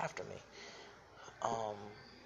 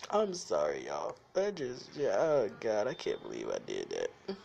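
louder, breathless from laughing; still laughing apology; 5 dB louder than usual. Real, not acted. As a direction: apologize while still giggling. Recovering from laughter.

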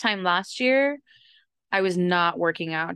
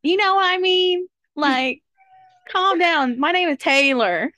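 much higher and louder, bubbly put-on friend voice; mock her switched voice, pitched way up and bright; 10 semitones higher, 5 dB louder than usual. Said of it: "she's always like".

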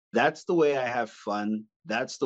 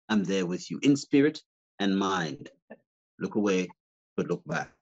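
put-on Old Spice guy; 6 semitones lower than usual. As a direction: purr it like a cheesy cologne ad. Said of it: "I would love to be that guy".

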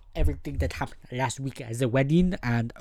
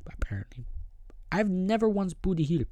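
whispered aside; 6 semitones higher than usual. Real, not acted.

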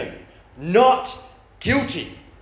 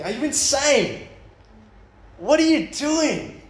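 high, drawn-out mock disbelief; hammed-up appalled questioning; incredulous exasperation; 12 semitones higher, slower than usual. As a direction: demand it slowly, pitched way up in disbelief. Acted disbelief at the toddler.

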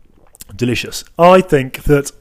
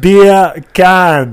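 loud, higher Jamaican "beer can"; Jamaican accent; 5 semitones higher, 9 dB louder than usual. Shown as a demonstration. Showing co-host the joke, loud Jamaican accent. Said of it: "beer can is the accent".

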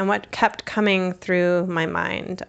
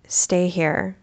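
retold hushed whisper. Mom leaving the child under the pine tree, whispered.

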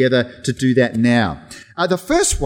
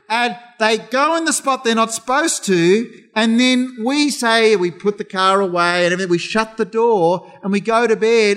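ordinary delivery

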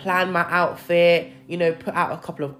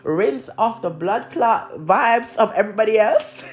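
slow, higher Jamaican lilt; Jamaican accent; 4 semitones higher, 3 dB louder than usual. Real, not acted. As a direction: slip into a Jamaican accent, drawn out. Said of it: "where this Jamaican accent is coming from".

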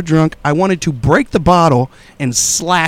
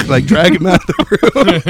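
usual speaking voice

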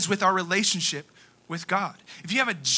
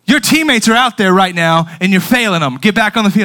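loud, raised scolding; coach berating; 4 semitones higher, 10 dB louder than usual. Retold or imitated. Coach scolding player who came out, loud berating. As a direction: order the player back, forcefully.

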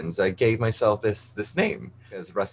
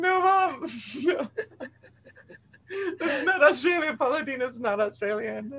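laughing protest. Real, not acted. Correcting mom while laughing.

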